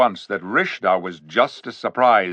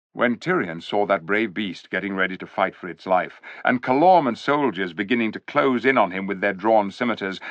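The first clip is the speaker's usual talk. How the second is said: plain reading voice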